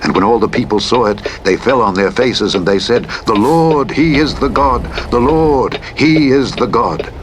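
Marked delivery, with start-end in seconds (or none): none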